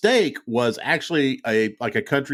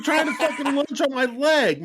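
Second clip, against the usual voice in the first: usual speaking voice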